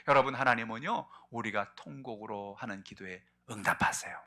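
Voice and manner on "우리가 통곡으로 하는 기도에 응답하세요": ordinary delivery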